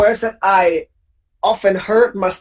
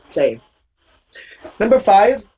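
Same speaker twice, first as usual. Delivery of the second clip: ordinary talk, nothing marked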